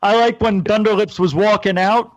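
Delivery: ordinary talk, nothing marked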